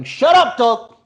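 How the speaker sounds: yelling at dog. Real, not acted. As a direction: snap loudly at the dog across the room.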